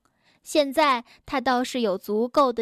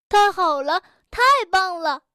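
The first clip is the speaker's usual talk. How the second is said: 大声欢快地喊; 开心得叫起来; 5 semitones higher, 4 dB louder than usual. Acted. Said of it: "跳跳高兴地说". (跳跳高兴地大声欢呼)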